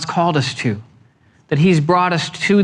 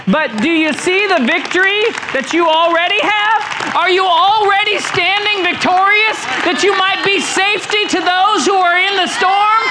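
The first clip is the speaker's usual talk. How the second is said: preaching at full force; 12 semitones higher than usual. Real, not acted. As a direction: lift the room, preach it out. Preaching climax to congregation.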